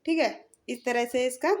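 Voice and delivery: normal talking voice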